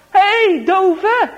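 high, loud jeer across the street; loud taunting shout. Acted. Bullies shouting after the deaf boy, jeering. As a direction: yell it cruelly after him. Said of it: "Ze roepen het heel hard".

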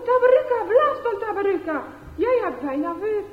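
put-on children yelling for Tabaruka to blow, loud; shouting harder. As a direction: scream encouragement at him. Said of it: "er wordt nog harder geroepen".

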